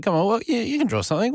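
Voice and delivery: pitched well up, retelling an eager coaxer; quoted urging. Retold or imitated. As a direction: lift the voice high to mimic the coaxing. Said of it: "and someone said".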